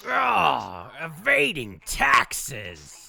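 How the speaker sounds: very slow, drawn-out villain growl; mustache twirling villain. Pretend. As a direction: savor the words like a scheming villain. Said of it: "it sounds more like Terry".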